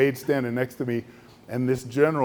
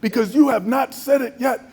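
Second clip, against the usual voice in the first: much higher and louder, shouting accusations; furious shouting general; 13 semitones higher, 5 dB louder than usual. Retold or imitated. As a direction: rage at the friend who betrays him. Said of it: "And then he goes".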